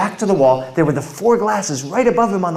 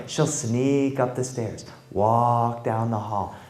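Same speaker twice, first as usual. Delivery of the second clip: soft, slow, creeping storyteller voice; ghost-story hush, slow and soft; hushed spooky narration; 4 semitones lower, 8 dB quieter than usual. A narrator's reading. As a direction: creep through the lines like sneaking up stairs.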